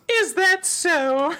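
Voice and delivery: high, very slow posh lady; drawn-out haughty teacher line. Acted. Reading the stern teacher in a slow, high posh voice. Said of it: "It's so posh".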